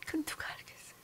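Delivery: soft unvoiced whisper. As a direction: confide it in a hush. 은밀한 속삭임. (비밀 얘기하듯 속삭이기)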